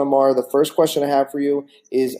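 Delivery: ordinary talk, nothing marked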